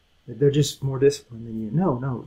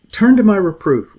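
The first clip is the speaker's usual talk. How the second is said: loud, high call to turn back; wisdom calling out; 6 semitones higher, 9 dB louder than usual. Retold or imitated. Wisdom pleading with the simple, loud high call. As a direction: call them out of their ways, loud and high.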